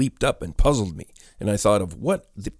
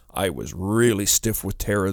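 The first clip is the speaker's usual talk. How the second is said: usual speaking voice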